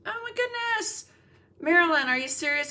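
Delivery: genuinely surprised. Real, not acted. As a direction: react like the news just hit you. Reading viewer's surprising news.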